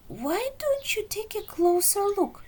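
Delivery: high, fast teasing cat voice; sly suggestion. Acted. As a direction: tempt him forward, quick and high. Clementine teases Lemon.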